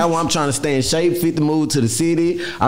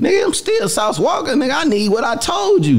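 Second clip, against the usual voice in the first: high indignant voice as [name]; a mock-indignant [name] claiming his due; imitating [name]; 13 semitones higher than usual. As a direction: raise the voice, insist you're still the star. Voicing [name] to others.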